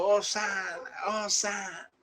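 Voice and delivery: crowd's hosanna cry. Retold or imitated. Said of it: "todo el mundo estaba gritando".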